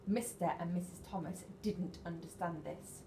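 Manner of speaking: plain reading voice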